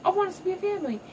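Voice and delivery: high, sweet begging voice; high girlish plea for family; pleading daughter. Retold or imitated. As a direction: plead for peace like a child. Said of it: "Kiara's like".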